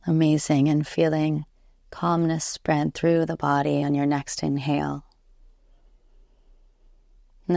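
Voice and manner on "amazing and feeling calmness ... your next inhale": ordinary talk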